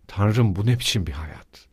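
ordinary delivery, nothing marked

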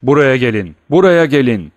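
very loud urgent summons; shouting. Acted. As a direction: bellow at them to come now. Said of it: "diye haykırdı".

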